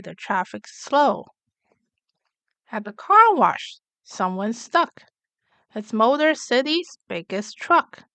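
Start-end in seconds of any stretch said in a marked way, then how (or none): none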